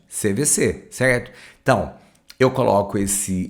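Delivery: ordinary delivery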